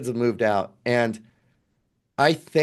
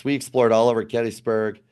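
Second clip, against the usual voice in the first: ordinary talk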